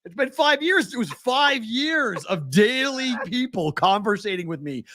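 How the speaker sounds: high-pitched, exasperated emphasis; still mad. Real, not acted. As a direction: vent years of pent-up frustration. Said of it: "I'm still so mad".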